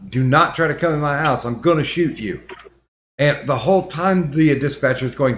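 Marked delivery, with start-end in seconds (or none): none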